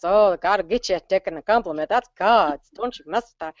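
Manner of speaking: loud, wavering pious warning; mock church admonition. Church member warning against taking a compliment, mock pious scolding. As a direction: mimic a pious scolding with swooping words. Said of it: "I was raised in the Nazarene church".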